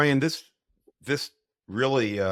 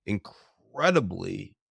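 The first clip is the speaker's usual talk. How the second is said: ordinary talk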